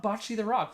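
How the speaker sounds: "Bocce" as BAH-chee with an open vowel; high-pitched, open-vowelled "Bocce the Rock"; imitated short-o pronunciation. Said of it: "You're saying Bocce the Rock".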